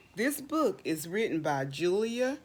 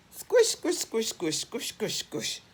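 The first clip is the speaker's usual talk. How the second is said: squishy sound effect; 2 semitones higher than usual. Acted. Reading to kids, playful fading mud squish. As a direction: play the squish like mud underfoot.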